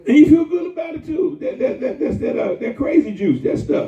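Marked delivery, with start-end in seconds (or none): 0.1-1.4 shout. Real, not acted. Preacher calling out sinners.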